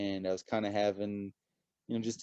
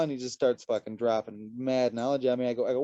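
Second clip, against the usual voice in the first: ordinary talk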